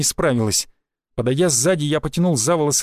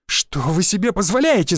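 higher-pitched, outraged outburst; indignant cry; 4 semitones higher than usual. A narrator's reading. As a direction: exclaim as if choking on outrage. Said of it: "задохнувшись от возмущения, вскричала она". (возмущённый вскрик)

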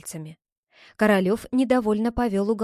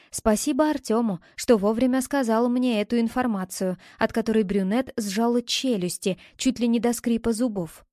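usual speaking voice

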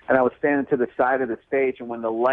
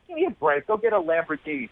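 high, scoffing give me a break; mock exasperation; 8 semitones higher than usual. Comic scoffing at a modest lottery winner, high mock disbelief.

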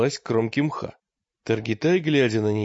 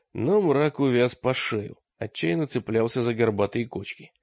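usual speaking voice

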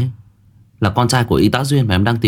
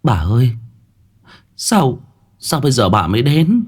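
high, choked-up plea; halting cry to a long-awaited grandmother; acted tearful reproach, pitch raised; overcome with emotion; 5 semitones higher than usual. A narrator's reading. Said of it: "xúc động".

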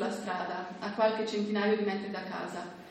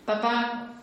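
called out; 3 semitones higher, 4 dB louder than usual. Acted. Calling father in flooded flat.

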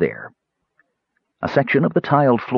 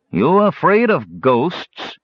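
sneering brigand; 8 semitones higher, slower than usual. A narrator's reading. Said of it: "said the dirty-faced fellow".

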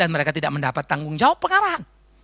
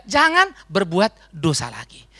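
slow, deliberate, every word weighed; slow solemn emphasis; slow emphatic; slower than usual. Shown as a demonstration. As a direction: lay it down slowly like a rule. Key phrase repeated slow.

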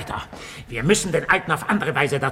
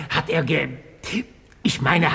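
ordinary talk, nothing marked

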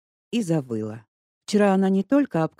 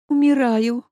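high, loud, drawn-out faint moan; Lesya, seeing the body bag, moaning; 9 semitones higher, 8 dB louder, slower than usual. A narrator's reading. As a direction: drag it out as if about to swoon. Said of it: "протянула она".

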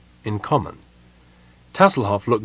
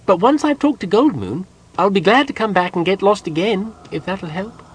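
high-pitched, placating kender voice; helpful kender; 10 semitones higher, 3 dB louder than usual. A narrator's reading. Kender leaving the angry gnome, placating. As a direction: offer help in a high, hopeful voice.

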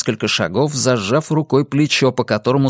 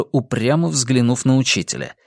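usual speaking voice

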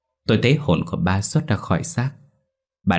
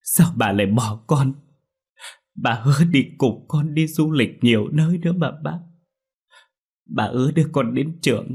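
weeping reproach, higher pitched; tearful lament; 6 semitones higher than usual. A narrator's reading. Daughter weeping over her father's body, high tearful lament. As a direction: plead through tears with someone who broke a promise. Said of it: "ôm lấy thi thể ... mà khóc".

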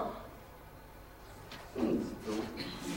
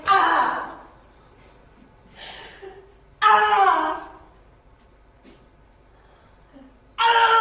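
rising loud anguished cries; hammed-up death screams; dying cries; 8 semitones higher, 6 dB louder, much slower than usual. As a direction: cry out as each dart strikes.

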